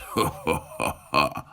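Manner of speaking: a low, wicked laugh; the dragon laughs over his meal; low evil dragon chuckle. Acted. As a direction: chuckle like a greedy monster. Dragon laughs before eating.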